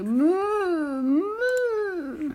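high, wailing moos; crying cow. Acted. The poor scolded cow cries, mooing high and sad. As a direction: moo as if weeping. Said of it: "la pauvre vache se mit à pleurer".

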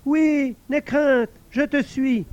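high, pleased voice; a lonely spirit delighted at last; invisible servant exclaiming. Acted. Servant thanking the guard, high excited voice.

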